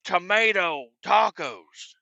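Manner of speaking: slow, drawn-out fury; slow raging accusation. Retold or imitated. Angry customer to the cook, slow drawn-out fury. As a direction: drag every word out in rage. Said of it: "I'm like".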